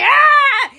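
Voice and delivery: long, high mock scream; loud hammed-up shriek from above; God's scream. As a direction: hold a horrified mock yell. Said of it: "God was up there like".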